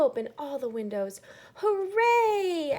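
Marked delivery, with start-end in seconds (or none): 1.6-2.8 high, loud cheer; joyful shout. Acted. Noah's family cheering as the windows open, loud joyful shout. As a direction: cheer like the sun just came out. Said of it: "everyone shouted".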